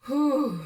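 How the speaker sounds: loud whew. Real, not acted. Catching breath after laughter.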